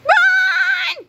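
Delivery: very high, loud yell; panicked boy's cry to run; cried out. A narrator's reading. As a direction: shout the alarm to flee. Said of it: "cried George".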